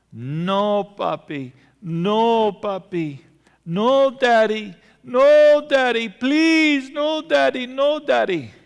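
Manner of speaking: súplica aguda y llorosa de una niña pequeña, cada vez más alta y fuerte; niña llorando y suplicando. Retold or imitated. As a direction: imita a una niña que ruega entre lágrimas que no la pinchen. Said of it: "mi hija empezó a rogarme, implorándome, llorando". (hija pequeña suplica a su papá ante la aguja, voz de llanto aguda)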